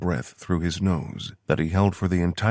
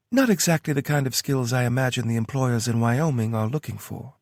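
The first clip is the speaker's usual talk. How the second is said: lightly higher woman's voice; Tara voice; 7 semitones higher, 3 dB louder than usual. A narrator's reading. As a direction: read her lines a bit higher. Tara's voice in story.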